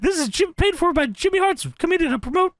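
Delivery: high manager voice disclaimer; brash manager announcement; shrill gloating wrestling manager. Retold or imitated. Reading Jimmy Hart promo.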